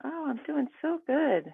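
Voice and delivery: sweet high put-on voice. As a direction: stretch it out way up high. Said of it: "the last one with the high voice".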